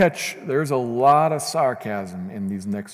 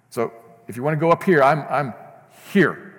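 voicing Christ, preached loud; 3 semitones higher, 4 dB louder than usual. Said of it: "That's Christ, says Paul".

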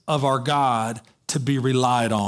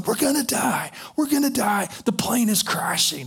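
much higher, panicked cry; panicked yelling; 7 semitones higher than usual. Retold or imitated. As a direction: imitate passengers sure they will die. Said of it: "people are yelling".

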